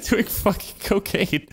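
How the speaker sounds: very high voice breaking into laughs; incredulous laughing. Real, not acted. Storyteller on friends snorting coke, laughing incredulously. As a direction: squeeze the words out through disbelieving laughter.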